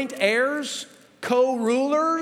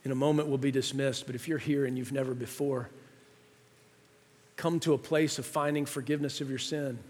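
much softer, lower pastoral closing; soft altar call; 8 semitones lower, 7 dB quieter than usual. Acted. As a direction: gently invite them into prayer. Soft close into prayer.

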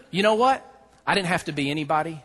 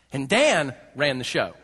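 normal talking voice

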